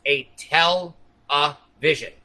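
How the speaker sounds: a tell-a-vision said very slowly, syllables Tell, a, vision pulled apart; slow, separated tell-a-vision, driving the point home; syllables split apart. Shown as a demonstration. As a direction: stretch tell-a-vision out like a lesson. Repeating the split-syllable word demo.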